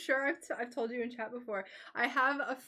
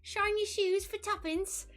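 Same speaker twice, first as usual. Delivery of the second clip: put-on high Cockney street urchin, pitched up; hammed-up Cockney accent, pitched higher; mock British accent; 8 semitones higher than usual. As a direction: ham up a London shoeshine boy begging. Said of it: "do the thing where it's like".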